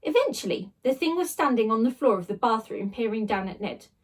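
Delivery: plain reading voice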